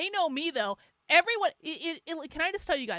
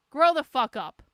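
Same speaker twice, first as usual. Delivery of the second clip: much louder "Grow the fuck up"; angry louder repeat; 8 dB louder than usual. Real, not acted. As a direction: shout it the second time.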